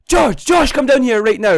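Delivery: high, loud call down; an annoyed mum summoning the boys downstairs, shouting; stern summons. Retold or imitated. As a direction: yell for the boys to come at once.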